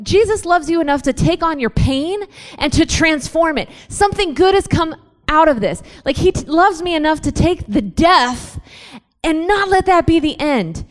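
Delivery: high, loud, impassioned build; full-force preaching. Acted. Speaker preaching to youth about Jesus' suffering, loud and rising. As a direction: preach it out to the crowd, pressing each line.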